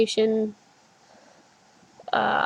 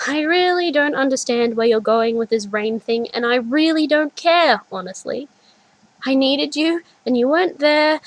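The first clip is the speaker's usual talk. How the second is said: louder, higher, angry blame; frustrated outburst; 4 semitones higher, 6 dB louder than usual. A narrator's reading. Adult self blames the child, angry and hurt. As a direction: let the hurt spill out.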